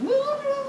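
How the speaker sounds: high-pitched mock whinny; whinny like a panicking horse. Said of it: "whinnying and going".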